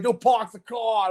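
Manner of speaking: high, slow mock Boston accent. Husband imitating his tipsy wife, Boston accent. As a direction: drop the r's in a drawn-out Boston accent. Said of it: "has a Boston accent".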